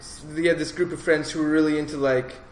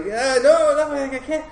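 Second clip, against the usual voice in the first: high, flustered mock protest; flustered refusal; 10 semitones higher than usual. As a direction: turn her down in a nervous squeak. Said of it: "and I'm like".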